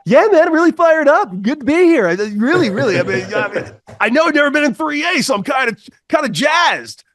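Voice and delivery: high, loud overeager voice; the newcomer telling Cody he's fired up, in a high, loud, eager voice; excited Jeff. Retold or imitated. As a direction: play him as a pumped-up rookie. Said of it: "and Jeff goes".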